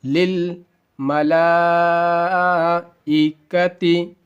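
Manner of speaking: للملائکتی slowly chanted, stretched before "ikati"; long madd. Shown as a demonstration. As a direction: hold the "laa" of للملائکتی very long and level. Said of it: "اسی طرح سے آپ کو بھی ہجے کرنا ہے".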